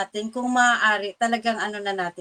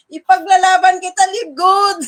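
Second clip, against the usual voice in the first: higher, louder, a fighting declaration; loud vow; 7 semitones higher, 10 dB louder than usual. Retold or imitated. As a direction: belt it out like a battle cry. Vowing to fight for Live Good. (malakas na panata)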